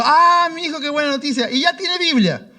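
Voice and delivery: high, delighted; delighted old lawyer. Retold or imitated. Abogado contento al saber. (el abogado, encantado, con voz más aguda)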